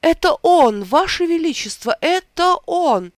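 high, loud cry of recognition; overjoyed recognition. A narrator's reading. Wizard crying out to the king in high joy. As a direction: exclaim it with rising joy.

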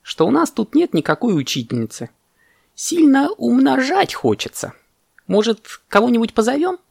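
high-pitched, longing monkey voice; wistful monkey. Acted. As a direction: plead it high and wishfully. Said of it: "выдохнула мартышка".

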